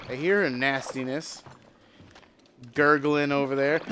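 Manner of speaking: ordinary talk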